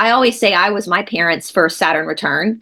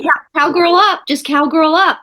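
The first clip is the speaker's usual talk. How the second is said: Southern saying; 6 semitones higher than usual. Retold or imitated. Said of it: "In the South, we say".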